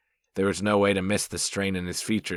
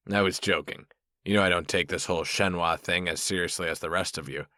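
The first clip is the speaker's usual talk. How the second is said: usual speaking voice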